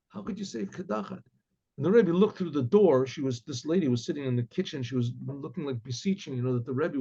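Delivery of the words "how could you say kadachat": softer, quiet reproach; hushed whisper. Retold or imitated. Wife quietly confronts husband privately.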